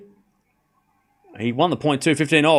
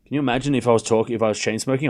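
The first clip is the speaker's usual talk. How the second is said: ordinary talk, nothing marked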